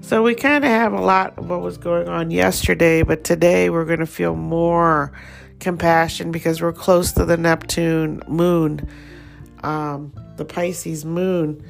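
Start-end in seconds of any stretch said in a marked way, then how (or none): none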